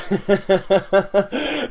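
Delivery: rapid chuckling laugh; amused rattling laugh. Real, not acted. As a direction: let out a long run of chuckles. Laughs after his own mock impression.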